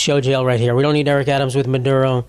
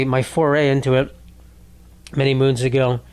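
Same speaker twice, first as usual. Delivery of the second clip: ordinary talk, nothing marked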